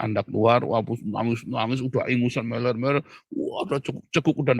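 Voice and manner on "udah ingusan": ordinary talk, nothing marked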